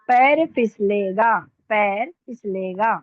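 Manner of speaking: slow dictation. Shown as a demonstration. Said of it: "अब यहाँ पे देखिए".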